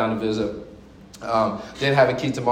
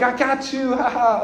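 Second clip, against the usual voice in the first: put-on high, grinning kid's gloat; gleeful little gotcha; smiling kid; 10 semitones higher than usual. Son gloating at his startled dad, high and grinning. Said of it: "he was all smiling like".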